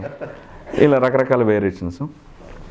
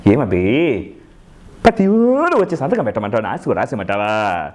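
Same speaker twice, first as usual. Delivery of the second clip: Jayaprakash Reddy, comic version; 8 semitones higher than usual. Acted. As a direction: stretch the words and climb into a high, whining rant. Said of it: "comedy చేసేటప్పుడు వాయిస్లో sharp వస్తూ ఉంటుంది". (Sharp గొంతుతో సాగదీస్తూ comedy villain యాస)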